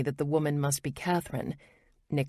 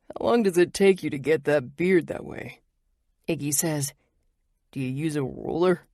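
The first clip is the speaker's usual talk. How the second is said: teasing. A narrator's reading. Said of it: "He teases".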